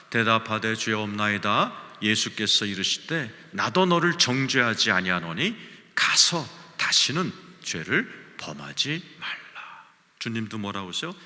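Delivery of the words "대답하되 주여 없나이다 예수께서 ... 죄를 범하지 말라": 회중과 함께 천천히 큰 소리로 성경 낭독; 다 같이 또박또박 천천히 읽기; congregational scripture reading. Real, not acted. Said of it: "같이 한번 읽어보죠". (예배 중 회중과 함께 성경 봉독, 느리고 큰 목소리)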